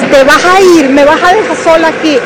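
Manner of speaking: high, loud reliving of the panic; panicked plea. Retold or imitated. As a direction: plead like you've been left trapped and alone. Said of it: "Entro en pánico". (súplica aterrada, más aguda y fuerte)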